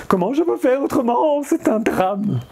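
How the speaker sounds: high, hammed-up tragic lament; mock drama. Vlogger mock-laments he can't shorten his book talk, high and hammed-up. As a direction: wail it like a tiny tragedy.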